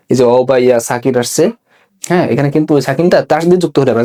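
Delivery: ordinary delivery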